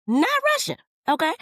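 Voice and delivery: high, loud delighted squeal; excited squeal. Real, not acted. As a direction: squeal in amazement. Gushing over actor's accent.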